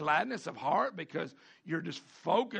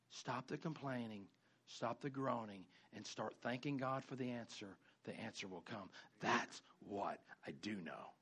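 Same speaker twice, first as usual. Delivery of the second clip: much lower and softer, almost confiding; soft, earnest answer; 6 semitones lower, 10 dB quieter than usual. Acted. Pastor softly answering a complaining member, hushed and earnest.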